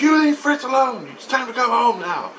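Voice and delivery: high-pitched imitation of a wife; wife giving orders. Wife tells husband to come home, high mock voice. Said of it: "she goes".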